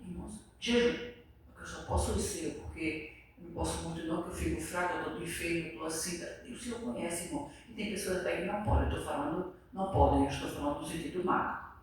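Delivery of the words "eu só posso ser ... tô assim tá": a soft, low, feeble whine of an excuse; weak feeble excuse. Retold or imitated. Mimicking a churchgoer excusing himself from the fast, quietly feeble. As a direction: sound too frail to fast, quietly.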